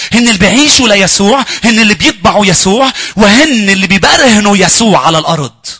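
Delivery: loud, high fervent sermon voice; preaching at full force. Acted. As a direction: proclaim it to the whole church. Preacher proclaims to congregation.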